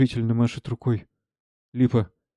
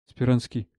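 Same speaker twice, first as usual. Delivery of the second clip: ordinary talk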